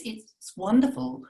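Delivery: ordinary talk, nothing marked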